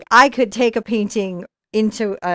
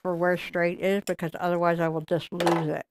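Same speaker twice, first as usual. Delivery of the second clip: talking around a brush in the mouth; words muffled by a paintbrush held in the teeth; 2 semitones lower than usual. Real, not acted. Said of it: "with a brush in my mouth".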